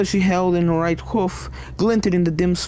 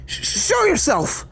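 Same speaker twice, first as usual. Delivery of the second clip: stuttered, much higher demand; frightened bark; 12 semitones higher than usual. Acted. Starlight demanding the unseen thing show itself, high and scared. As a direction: bark out a shaky command into the dark. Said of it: "she barked".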